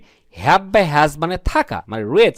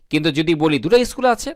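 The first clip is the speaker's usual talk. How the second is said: ordinary talk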